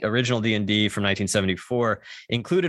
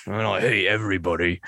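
Roy Kent; 3 semitones lower than usual. Retold or imitated. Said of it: "Roy Kent from Ted Lasso".

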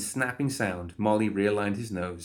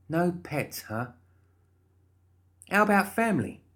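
cheery Molly voice; 10 semitones higher than usual. Acted. Molly interviewing guard.

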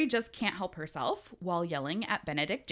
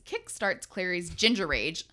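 ordinary talk, nothing marked

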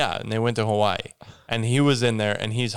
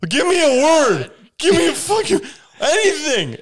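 shrill panicked little brain voice; frantic high yell; 8 dB louder than usual. Acted. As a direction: screech it high and loud, desperate. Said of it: "he's like".

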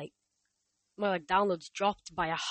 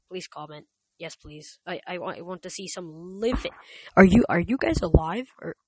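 ordinary delivery

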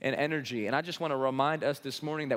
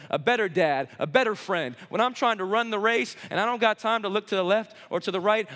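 high, loud climactic preaching; preaching at full force; 5 semitones higher, 4 dB louder than usual. Acted. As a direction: drive the congregation to the peak. Sermon climax.

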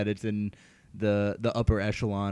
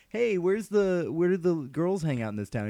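high creepy sheriff voice; 7 semitones higher than usual. Retold or imitated. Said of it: "he's like".

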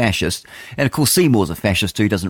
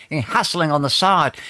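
mock trendy phrase; 7 semitones higher than usual. Mocking the trendy term.

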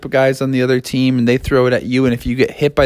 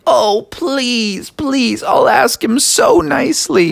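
put-on high-pitched begging kid; wheedling child voice; Froggy; 10 semitones higher, slower than usual. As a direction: plead sweetly, pitched way up. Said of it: "Froggy was like".

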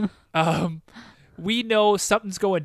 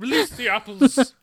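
higher, louder hammed-up battle cry; mock dramatic; 6 semitones higher, 5 dB louder than usual. Co-host echoing the apple scene to his partner, mock dramatic. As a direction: proclaim it with mock grandeur. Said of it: "It is very dramatic".

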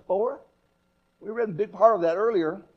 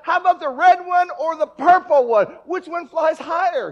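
put-on higher, louder excited kid; eager boy; 8 semitones higher, 8 dB louder than usual. The boy runs back to the balloon man, excited and louder. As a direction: beg the question eagerly.

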